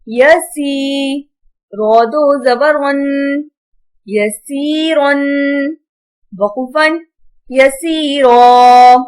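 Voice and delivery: slow, measured recitation with long-held vowels; Quran spelling drill. Shown as a demonstration. Teacher spells out Arabic words for pupils, drawn-out recitation. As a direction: chant each syllable out for pupils.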